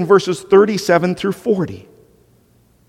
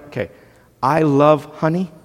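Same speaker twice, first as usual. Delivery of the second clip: ordinary talk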